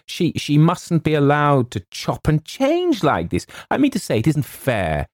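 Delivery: flustered, high-pitched protest; indignant cry. A narrator's reading. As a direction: sputter with outraged disbelief. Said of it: "cried James".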